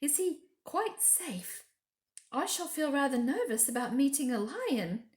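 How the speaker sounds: nervous Susan. Acted.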